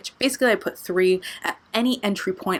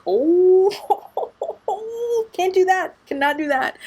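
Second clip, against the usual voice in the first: high squealed recoil breaking into laughter; mock panic; 11 semitones higher, 3 dB louder than usual. Retelling getting close to a screeching rat, mock-panicked. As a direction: squeal out loud, then back off high and giggly.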